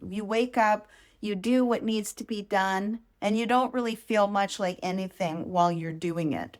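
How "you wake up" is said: normal talking voice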